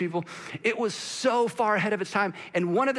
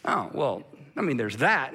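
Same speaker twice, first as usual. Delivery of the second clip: sheepish concession; 6 semitones lower than usual. Retold or imitated. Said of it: "they're like".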